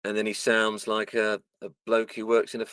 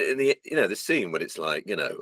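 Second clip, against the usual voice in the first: ordinary delivery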